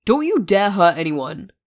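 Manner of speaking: slow, loud growled warning. A narrator's reading. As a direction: growl the threat slowly. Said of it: "Hisashi growled".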